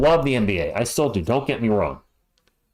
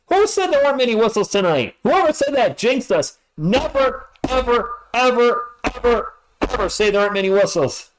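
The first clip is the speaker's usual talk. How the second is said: exasperated rant; 9 semitones higher than usual. Real, not acted. Frustrated at too many whistles.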